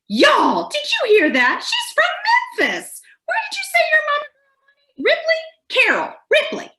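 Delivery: loud, very high Southern squawk; hammed-up excited Southern lady, shrieking high; loud Southern tourist. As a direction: holler the news to friends in a Southern accent. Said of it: "they tend to talk loudly in familiar accents".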